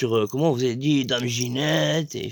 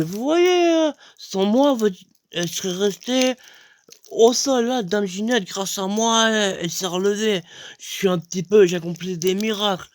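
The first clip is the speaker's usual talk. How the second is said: boastful; 6 semitones higher than usual. Retold or imitated. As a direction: puff yourself up as the miracle worker. Narrator boasts of his miracle. (vantard, voix haute et forte)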